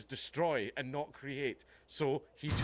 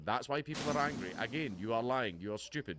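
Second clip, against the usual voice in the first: ordinary talk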